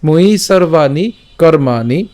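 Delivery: "ময়ি সর্বাণি কর্মাণি" slowly, long vowels drawn out; pronunciation demo. Shown as a demonstration. Teacher models "ময়ি সর্বাণি কর্মাণি" for learners, slow and measured. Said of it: "আবার যদি প্রথম থেকে বলি".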